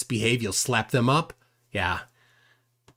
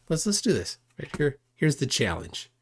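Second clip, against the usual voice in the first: ordinary talk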